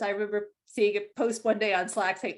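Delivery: normal talking voice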